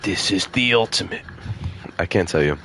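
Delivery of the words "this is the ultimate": lightly voiced edgy antihero; a hint of brooding cool; Shadow voice. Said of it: "It's my Shadow voice".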